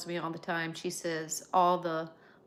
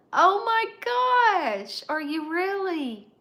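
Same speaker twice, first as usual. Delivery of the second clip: high, loud delighted gasp; excited surprise; 11 semitones higher, 10 dB louder, slower than usual. Real, not acted. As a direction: squeal it with joy at the news. Excited by an English viewer.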